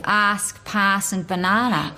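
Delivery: broad Australian accent with a drawn-out "a" in "pass" and "banana". Shown as a demonstration. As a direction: lean hard into broad Aussie vowels on "Ask, pass, and banana". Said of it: "something quite broad".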